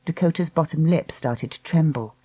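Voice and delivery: ordinary delivery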